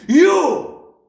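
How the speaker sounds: very loud, high bark. A narrator's reading. Headmistress picking out a boy, barking. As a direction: snap the single word out. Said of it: "she barked".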